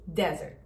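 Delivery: Desert com e aberto, dézert, tônica no de; open e, stress first. Shown as a demonstration. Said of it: "O E também é aberto". (desert com e aberto na primeira sílaba)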